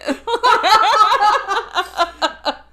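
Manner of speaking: high loud burst of laughter. Real, not acted. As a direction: crack up loudly. Laughing at glitter purchase.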